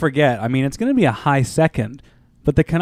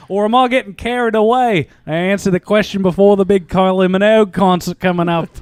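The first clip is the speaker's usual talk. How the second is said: higher-pitched mock Australian accent; bad Australian accent; 4 semitones higher than usual. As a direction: keep up a badly faked Aussie accent. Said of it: "I'm just gonna do a bad Australian accent".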